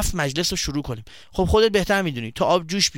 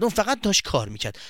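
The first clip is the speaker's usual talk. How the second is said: usual speaking voice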